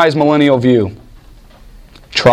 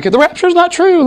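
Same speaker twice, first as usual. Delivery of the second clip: mock zealous convert. Mimics the woman preaching excitedly.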